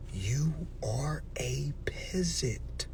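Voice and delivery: slow breathy whisper. Acted. Whisper the insult to the viewers. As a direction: hush it like a secret.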